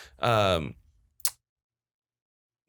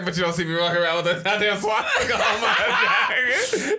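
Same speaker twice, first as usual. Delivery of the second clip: laughing while quoting; 7 semitones higher than usual. Retold or imitated. Retelling the German guy's reply, laughing. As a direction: quote broken up by laughter, pitched higher. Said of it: "He's like".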